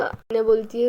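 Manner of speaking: ordinary delivery, nothing marked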